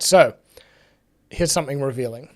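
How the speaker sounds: ordinary talk, nothing marked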